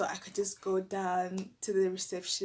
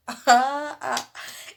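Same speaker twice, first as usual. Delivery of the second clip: big burst of laughter; real loud laugh; 4 semitones higher, 12 dB louder than usual. Real, not acted. Laughing at the memory.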